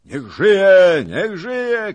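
high, very loud cheer; exultant yelled hurrah; crowd shouting. A narrator's reading. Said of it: "krzyczała szlachta".

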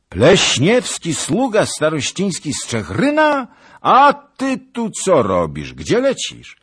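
high, loud startled questions; astonished shouting. A narrator's reading. Zagloba recognises the starosta's servant, astonished shout. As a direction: shout questions at a galloping acquaintance.

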